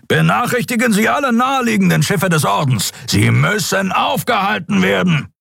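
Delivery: high, shouted command; rally the fleet by shouting; shouted order. A narrator's reading. Captain orders all ships. (Kapitän ruft der Crew laut einen Befehl zu)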